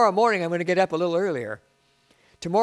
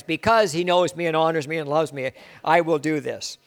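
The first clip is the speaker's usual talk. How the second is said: ordinary talk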